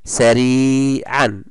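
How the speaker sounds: سريعا read slowly with a clear an ending; slow tanween reading. Shown as a demonstration. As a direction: drag out the tanween. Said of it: "تنوين الفتح". (نطق بطيء للتنوين)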